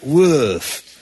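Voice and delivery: woof with a French vowel, a hint of French; a lightly French-accented woof. Shown as a demonstration. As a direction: stretch the vowel like a French bear. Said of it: "I was doing a French woof".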